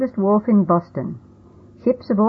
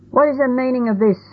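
higher, commanding challenge to the sailors; stern grandfather confronting blockers; shouted demand; 7 semitones higher, faster than usual. A narrator's reading. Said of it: "Grandfather shouted to the men".

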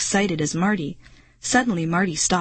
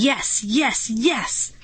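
higher-pitched triumphant cheer; excited cheering; 5 semitones higher, slower than usual. A narrator's reading. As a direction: cheer like a thrilled boy. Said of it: "shooting both fists into the air".

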